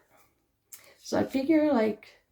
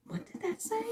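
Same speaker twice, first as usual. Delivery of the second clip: surprised; 11 semitones higher, 10 dB quieter than usual. Retold or imitated. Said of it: "and I was like".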